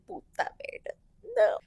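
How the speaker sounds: breathless whispered curse; retold whisper of dread on seeing the bride's message; panicked whisper. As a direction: whisper it under your breath, frozen.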